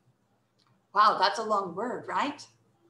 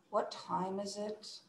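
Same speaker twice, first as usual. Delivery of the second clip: breathy huff, then slow, low-volume annoyance; annoyed; 3 semitones lower, 12 dB quieter than usual. Shown as a demonstration. Teacher modelling for students how to sound annoyed. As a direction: huff and drag the words quietly. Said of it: "Sound annoyed when you say".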